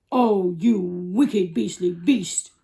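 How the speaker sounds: slow, loud elephant outcry; Trunky. Acted. Elephant condemning the crocodile, loud and slow. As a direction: cry out big and drawn-out. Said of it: "cried Trunky".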